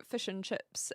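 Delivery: lightly done New Zealand accent; Kiwi accent. Shown as a demonstration. Streamer obliging viewers with fish and chips, mild Kiwi accent. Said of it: "a, a strong Kiwi accent".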